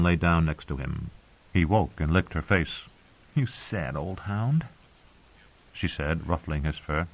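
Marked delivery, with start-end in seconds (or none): 3.4-4.7 soft, slow and tender; gently to an old dog. A narrator's reading. Sansa's soft tender line.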